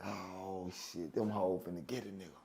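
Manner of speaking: very low, hushed dread; sinking, almost under the breath; retold realization. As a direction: mutter it low to yourself. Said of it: "I said".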